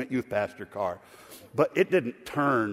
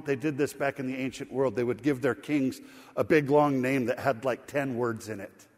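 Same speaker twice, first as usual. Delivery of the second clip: normal talking voice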